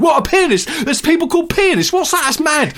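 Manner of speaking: high, loud, flabbergasted shouting; hammed-up hyperactive presenter; Greg Wallace. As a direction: bellow each phrase in amazement. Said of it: "a day in the life of Greg Wallace".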